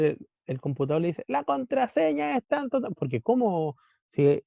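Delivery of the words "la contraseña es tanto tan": pitched high, shouted-out password; calling out the password. Acted. Laptop shouting its password to the router, high call. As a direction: yell it across the room to the router. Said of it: "Conversan a gritos".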